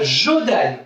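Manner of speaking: Jodan said as a falling model word, jo-DAN; model pronunciation. Shown as a demonstration. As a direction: say Jodan clearly for kids to copy. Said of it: "Ils doivent dire comme ça". (Jodan prononcé comme modèle, bien net)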